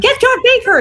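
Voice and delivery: a high mock yell for help; retold scream. Girls on set scream for help, high mock yell. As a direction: shout it like a frightened kid calling for help. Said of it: "Laura and Mary have screamed".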